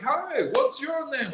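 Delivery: high, slow friendly question; lightly played Danish parent. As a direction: put on a lilting mock parent voice. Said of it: "talking, like".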